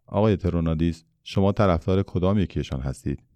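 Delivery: plain reading voice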